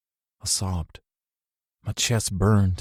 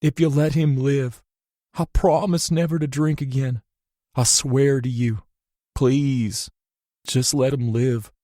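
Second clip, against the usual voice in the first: desperate bargaining; 5 semitones higher than usual. A narrator's reading. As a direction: plead with God, holding back tears. Bargaining with God at bedside.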